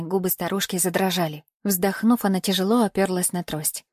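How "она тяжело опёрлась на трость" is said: usual speaking voice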